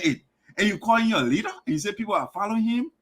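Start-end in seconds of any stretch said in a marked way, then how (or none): none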